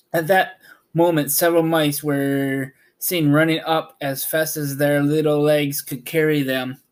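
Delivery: usual speaking voice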